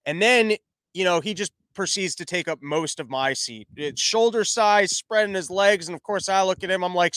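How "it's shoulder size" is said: normal talking voice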